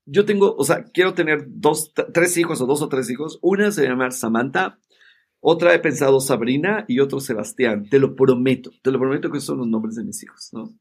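ordinary delivery

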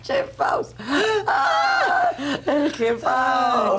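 risa aguda y chillona. Pretend.

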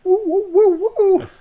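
party horn noises; high hooting party horn whoops; party blower. Real, not acted. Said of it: "I like how you gave, like, the par-, the party horn noises".

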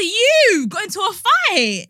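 incredulous echo of her words; shocked disbelief. Retold or imitated. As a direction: stretch it out in shock. Retelling shocked reply to sister.

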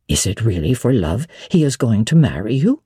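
a hint of a flustered elderly widow; lightly voiced old lady, puzzled and hesitant; bewildered old woman questioning Jane gently; old housekeeper. Mrs Fairfax voiced low.

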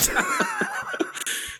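a high, shrieking burst of laughter. Real, not acted. Gloating over New Zealand on the medal tally, shrieking laugh.

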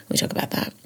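a quick whispered aside. Real, not acted. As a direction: breathe it out unvoiced. Unvoiced aside.

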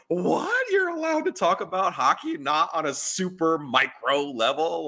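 high, squeaky mock surprise; mock astonishment. His brain reacting to the hockey talk, mock amazed and high. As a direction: gasp it out like you can't believe it. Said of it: "my brain was like".

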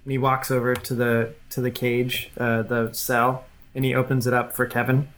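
ordinary talk, nothing marked

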